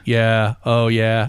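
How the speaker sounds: deep, laid-back robot voice; low, cool robot. Shown as a demonstration. Podcaster demonstrating the robot voice he wants for Barry, low and cool. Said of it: "like the low, the, the cool robot".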